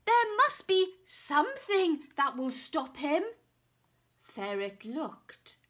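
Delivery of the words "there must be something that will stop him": loud, higher urgent plea. Acted. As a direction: say it in a hurry of alarm. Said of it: "called the knight".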